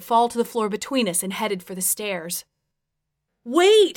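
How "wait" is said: loud, high, panicked call; urgent high plea. A narrator's reading. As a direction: cry out in rising panic. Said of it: "with even more panic".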